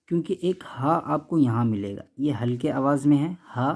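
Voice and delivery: normal talking voice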